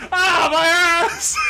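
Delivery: mock howl of pain; pained yelp. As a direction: yelp like you just got hit in the butt. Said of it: "He's like".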